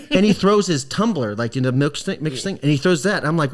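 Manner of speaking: normal talking voice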